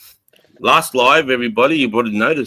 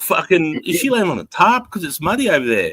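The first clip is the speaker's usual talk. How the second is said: baffled; 7 semitones higher than usual. Retold or imitated. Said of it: "'Cause I'm like".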